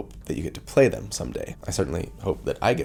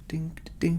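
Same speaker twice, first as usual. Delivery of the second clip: whispered ding ding; 9 semitones higher, 6 dB quieter than usual. Pretend. Mimics a bell after song.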